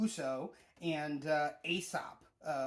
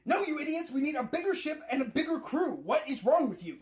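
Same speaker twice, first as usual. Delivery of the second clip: much higher, louder mock scolding; exasperated Nami scolding; 11 semitones higher, 7 dB louder than usual. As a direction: yell at the idiots like a fed-up navigator. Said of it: "And then Nami's just like".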